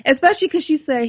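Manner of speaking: ordinary talk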